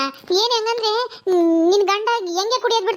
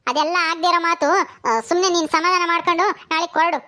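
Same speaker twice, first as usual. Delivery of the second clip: usual speaking voice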